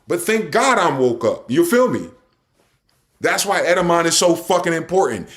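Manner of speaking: ordinary talk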